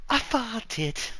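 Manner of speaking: high-pitched put-on Irish accent. Man playing with an accent after farting, Irish accent. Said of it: "or the Irish accent".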